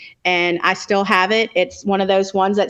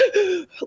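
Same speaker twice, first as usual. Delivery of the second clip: a sharp sobbing gasp, imitated; demo sob gasp; 10 semitones higher, 5 dB quieter than usual. Her sobbing gasp for the others. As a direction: gasp in as if crying hard. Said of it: "like this".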